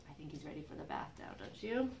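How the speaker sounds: ordinary delivery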